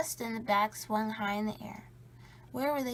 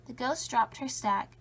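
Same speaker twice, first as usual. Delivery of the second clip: plain reading voice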